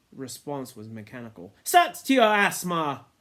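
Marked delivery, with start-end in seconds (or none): 1.6-3.1 loud, dismissive jab; scornful retort. A narrator's reading. Ralph dismisses Piggy's asthma, loud scorn. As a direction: brush his complaint aside.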